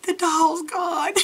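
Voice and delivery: high, drawn-out retold wail; hammed-up stunned dismay; mock distress. Telling Gerald the doll vanished, mock-distressed.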